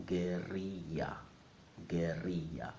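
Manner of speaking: guerrilla as geh-RREE-ya, ll like y; Spanish pronunciation. Shown as a demonstration. Teacher modelling Spanish for learners, guerrilla as geh-RREE-ya. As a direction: say guerrilla slowly in Spanish, no l sound. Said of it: "In Spanish, it's pronounced".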